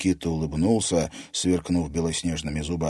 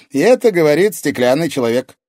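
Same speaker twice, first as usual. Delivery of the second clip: put-on high, loud teasing boy; cheeky teenage retort; boyish character voice; 9 semitones higher, 10 dB louder than usual. A narrator's reading. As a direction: tease him in a ringing lad's voice. Boy teases back in character.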